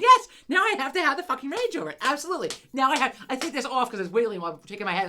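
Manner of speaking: high, loud exasperated rant; retold rage, pushed up high and loud; raging. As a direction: snap back, fully worked up. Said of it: "have the rage over it".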